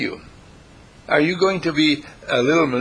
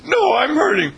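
high, louder mock child whine; 5 dB louder than usual. Child complains to mother in a high whine. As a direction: whine like a hurt little kid. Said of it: "You know".

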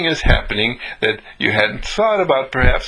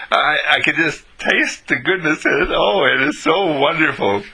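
higher, hammed-up rapture; overboard gushing; 4 semitones higher than usual. Grateful eater praises a homemade breakfast, overboard gushing. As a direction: gush as if overcome by the taste. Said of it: "you're going overboard".

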